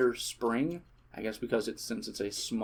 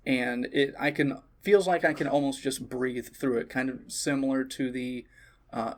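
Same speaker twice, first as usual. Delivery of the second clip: ordinary delivery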